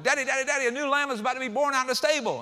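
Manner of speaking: put-on high, fast child calling his daddy; little boy bursting in at dinner, excited. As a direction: burst in breathless with big news.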